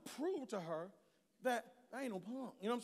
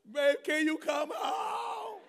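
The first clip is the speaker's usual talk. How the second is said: slow, loud, high-pitched beg; desperate plea; 7 semitones higher, 9 dB louder, much slower than usual. Retold or imitated. Worn-out dad begging his wife to come home, loud and slow. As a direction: cry out for rescue, drawn out.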